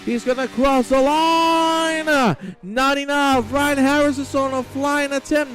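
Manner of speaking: high, excited race announcer; live race commentary. Acted. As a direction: call a last-gasp finish, holding the key words long.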